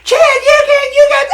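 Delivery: very high mock scolding; imitated mom yelling. Mom yelling at the driver, high-pitched mock protest. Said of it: "my mom started yelling".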